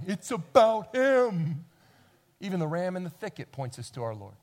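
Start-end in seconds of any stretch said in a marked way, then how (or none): 0.0-1.7 very slow, loud pronouncement; sign proclaiming. Retold or imitated. The Bible as a neon sign, slow and loud. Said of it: "a big neon sign pointing to Jesus going".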